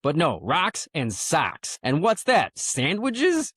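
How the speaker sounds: high, slow, brash bandit-woman voice, no drawl; [name]'s reading. Acted. Podcaster reading the bandit leader's lines to friends, high and brash. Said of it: "continuing [name]'s quote".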